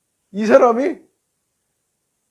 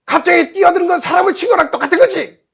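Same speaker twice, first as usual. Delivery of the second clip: high, loud imitation of a furious rider; angry shouting; 8 semitones higher, 5 dB louder than usual. Rider insisting that cutting in equals hitting someone, angry shouting. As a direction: shout the accusation down at him. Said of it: "막 화를 내고 욕을 하고".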